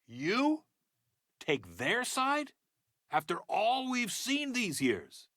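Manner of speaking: slow, weighty, high-pitched indignation; slow, emphatic reproach with big pitch swings; indignant disbelief. Acted. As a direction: spell it out slowly, incredulous at the betrayal. Acted confrontation over siding with Skirmishers.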